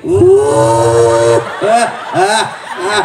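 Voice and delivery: hammed-up sobbing gasps of a widow; fake-sob like a grieving wife; mock sobbing. Shown as a demonstration. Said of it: "Nangisnya begini".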